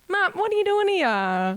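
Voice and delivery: high, slow, put-on outraged voice; hammed-up indignant ma'am; mock outrage. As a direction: drawl it out in mock shock. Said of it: "You're like".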